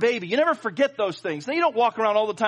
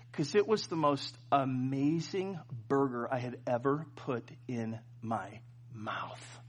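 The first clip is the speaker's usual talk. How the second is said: much softer, lower, slowing on the last words; awed hush; 8 semitones lower, 11 dB quieter, slower than usual. Real, not acted. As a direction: savor it in a hushed voice. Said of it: "awed testimony".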